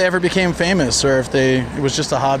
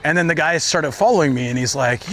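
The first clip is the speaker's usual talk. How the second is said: usual speaking voice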